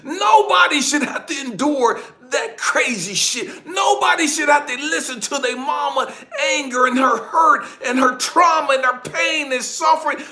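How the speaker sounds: high, loud, impassioned tirade; outraged protest. Retold or imitated. Father protests how his ex-wife treats their children, outraged. Reliving anger at ex-wife's yelling.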